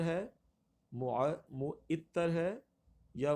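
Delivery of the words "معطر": معطر slowly as mu-it-tar; pronunciation option. Shown as a demonstration. Teacher offers another choice, slow mispronunciation. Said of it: "یہ کیا یہ تلفظ".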